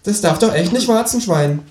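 high, mock-innocent animal voice; teasing kangaroo. Kangaroo mocking the narrator's living from wordplay, high teasing. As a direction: needle them in a high cheeky voice.

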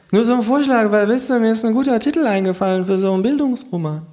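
hohe Figurenstimme, beiläufig vorschlagend; Känguru. Acted. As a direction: mit hoher Stimme die Idee anpreisen. Känguru erklärt seine Idee. (hohe Känguru-Stimme)

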